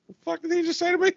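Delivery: high, fast, incredulous mock outrage; stunned disbelief. A mechanic replaying his stunned reaction to a condescending tech, high and fast. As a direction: squeak it out in disbelief at the insult.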